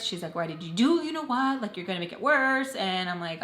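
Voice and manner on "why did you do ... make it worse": higher, louder mock scolding; exasperated friend's scolding, raised in pitch; retold scolding. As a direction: imitate a friend telling you off. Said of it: "scolding me".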